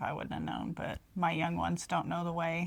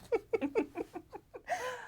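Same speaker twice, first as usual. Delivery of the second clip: witch laugh; 6 dB quieter than usual. Acted. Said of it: "Very spooky".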